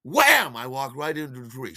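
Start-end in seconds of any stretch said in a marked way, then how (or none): 0.0-0.5 high, loud impact shout; explosive exclamation; crash effect. Retold or imitated. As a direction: slam the word like a collision. Imitating impact with tree.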